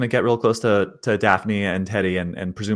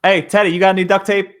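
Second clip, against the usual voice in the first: higher and louder, shouting across; calling out; 9 semitones higher, 6 dB louder than usual. Acted. Calling to Teddy across the car, loud.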